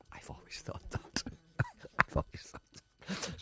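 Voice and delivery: breathy whisper, laughing. Real, not acted.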